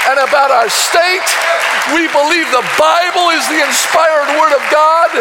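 loud, rising preacher's cry; rally speech. Acted. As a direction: preach it at full force. Preaching at full force to the congregation.

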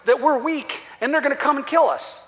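high-pitched, put-on worried whine; laid-on-thick helpless complaint; mock whining. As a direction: whine it like someone gone to pieces. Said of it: "he's whining to him".

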